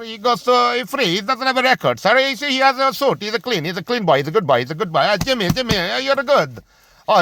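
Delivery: high, mock-polite official voice; sneering mock bureaucrat, pitched well up. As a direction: ham up a smug official letting the rich go. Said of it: "They're like".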